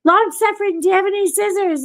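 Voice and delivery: yelling to the husband in the next room; yelling across the apartment. Retold or imitated. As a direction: call out to another room. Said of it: "And I yell".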